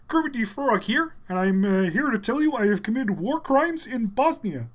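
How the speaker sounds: high puppet frog voice; Kermit. Acted. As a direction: announce it as an earnest frog puppet. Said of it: "give me something to say as Kermit".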